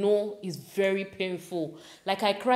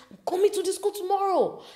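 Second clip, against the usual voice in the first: angry dad; 11 semitones higher than usual. Retold or imitated. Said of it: "he said".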